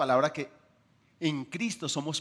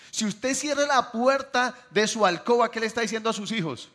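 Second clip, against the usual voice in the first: emphatic preaching; 6 semitones higher, 6 dB louder than usual. Acted. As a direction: press the question on the congregation.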